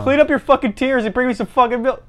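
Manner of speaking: high mock-bullying demand; bratty son. Son demanding milk from crying mother, mock-angry.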